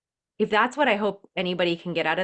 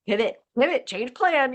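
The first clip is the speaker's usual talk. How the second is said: mock frantic; 6 semitones higher, 4 dB louder than usual. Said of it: "roller coaster I've seen".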